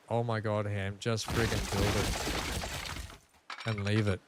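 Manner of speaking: a hint of a tired viewer, soft and slow; lightly mock the fed-up audience, drawn out; exasperated viewer. Streamer imitates viewers telling him to just build, mildly fed up. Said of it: "everyone else is probably like".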